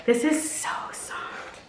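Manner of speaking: slow hushed whisper. Real, not acted. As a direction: breathe it, savouring the softness. Whispering over a soft sweater.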